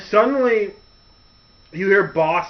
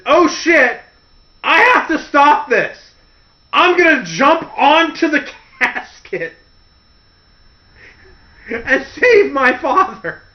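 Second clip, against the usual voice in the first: mock panic; 7 semitones higher, 4 dB louder than usual. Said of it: "His first reaction is".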